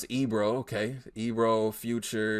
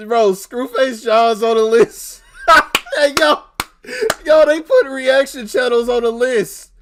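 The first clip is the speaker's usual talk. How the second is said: loud, high, laughing disbelief; amazed laughter; 9 semitones higher, 10 dB louder than usual. Real, not acted. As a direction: crack up while calling out the surprise. Amazed reaction channels made the list.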